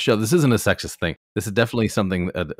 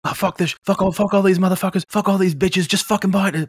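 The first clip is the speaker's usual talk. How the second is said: high, fast put-on rant voice; hammed-up squeaky cursing fit; mock unhinged outburst; 13 semitones higher, 4 dB louder than usual. Co-host plays out intrusive thoughts, high frantic mock rant.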